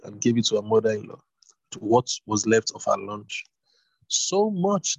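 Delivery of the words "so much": higher delighted gasp of a voice; a touch of amazed old woman; surprised exclaim. A narrator's reading. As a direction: exclaim at the heap of barley. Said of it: "Naomi exclaimed".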